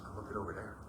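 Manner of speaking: very soft, low murmur; mumbled. Retold or imitated. Brother answering without moving, very soft murmur. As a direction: barely say it, not moving. Brother too still to move.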